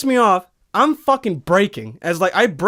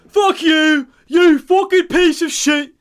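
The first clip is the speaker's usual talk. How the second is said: high, loud, drawn-out yell; retold yelled insult; 9 semitones higher, 5 dB louder, slower than usual. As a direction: hurl the insult after the departing driver. Retelling his parting insult, building.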